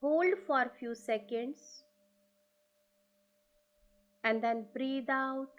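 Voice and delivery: slow, drawn-out breathing instructions; calm breath coach, unhurried; guided breathing cues. Acted. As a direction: stretch each word like a long exhale. Leads slow breathing exercise.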